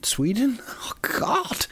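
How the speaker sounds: high whispered shriek; horrified realisation. Real, not acted. Host catching his own mistake, a high whispered gasp. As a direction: gasp at your own blunder.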